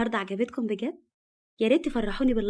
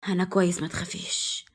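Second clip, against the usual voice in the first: reassuring; 2 semitones lower, 5 dB quieter than usual. A narrator's reading. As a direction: reassure her quietly, letting the voice drop away. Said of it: "وهو بيحاول يطمنها".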